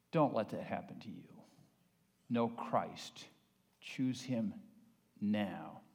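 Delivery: soft, low, earnest altar call; a hushed, earnest invitation; quiet closing appeal. Acted. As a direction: draw them in gently at the close.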